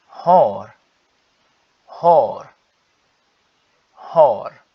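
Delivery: "har" with a long a and sounded R; Swedish har. Shown as a demonstration. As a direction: drill "har" clearly, R at the end. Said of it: "eu tô pronunciando o R".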